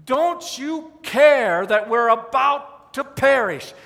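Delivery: loud, slow, drawn-out plea; desperate yelling. Acted. Disciples yelling at sleeping Jesus in the storm, desperate. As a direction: yell to be heard over a storm. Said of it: "they're yelling at Jesus".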